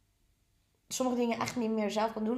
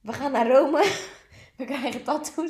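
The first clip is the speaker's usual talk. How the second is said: mock dramatic; 4 semitones higher, 9 dB louder than usual. Real, not acted. Mock ominous joke, laughing.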